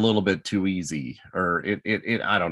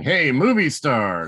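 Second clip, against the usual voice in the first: teasing; 7 semitones higher, 4 dB louder, slower than usual. Retold or imitated. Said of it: "kept teasing him and saying".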